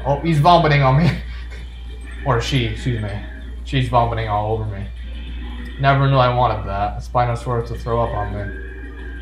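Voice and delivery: ordinary talk, nothing marked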